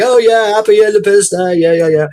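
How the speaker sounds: high, loud sheepish backpedal; flustered cover-up. Retold or imitated. American backpedalling to a Brit, high flustered. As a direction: cover the blunder brightly. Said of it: "it's like".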